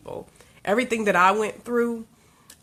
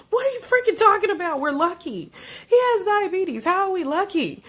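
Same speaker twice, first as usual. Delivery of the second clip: much higher, loud, fast outraged retort; outraged disbelief; 12 semitones higher, 4 dB louder than usual. Retold or imitated. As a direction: parents to lucky-calling doctors, outraged shouting. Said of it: "we're just looking at them like".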